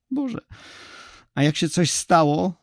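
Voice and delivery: high, breaking cry of a frightened mother. Acted. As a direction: let the voice crack with fear for her daughter. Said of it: "zawołała łamiącym się głosem".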